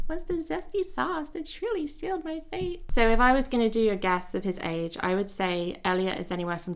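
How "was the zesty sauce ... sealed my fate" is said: high, slow hammed-up British accent; mock British accent. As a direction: ham up the British accent, pitched way up. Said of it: "I sound ridiculous saying it".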